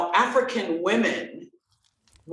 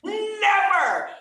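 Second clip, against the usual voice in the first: shouted never; 11 semitones higher than usual. Real, not acted. Telling about a voice never heard before, shouting "never". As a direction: shout the second "never" much higher. Stressing a new voice.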